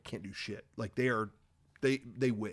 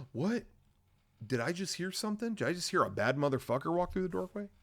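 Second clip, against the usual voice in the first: gasp, then high, fast, softer questioning; mock startled; 6 semitones higher, 4 dB quieter, faster than usual. Villains suddenly hear someone at the doorway, mock-startled wonder. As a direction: build mock suspense in a quick high hush.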